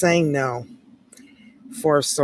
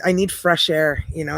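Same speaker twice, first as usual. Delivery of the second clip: usual speaking voice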